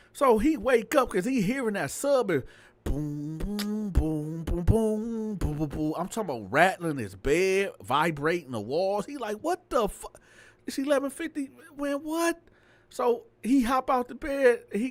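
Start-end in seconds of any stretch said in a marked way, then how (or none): 2.8-5.9 imitated bass thump. Imitating the neighbour's car bass. 9.4-12.4 startled. Retold or imitated. Landlord woken by loud bass.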